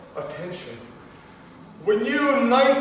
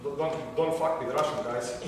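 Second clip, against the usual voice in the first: mock threat; 7 semitones lower, 5 dB quieter than usual. Mock threat to keep him performing.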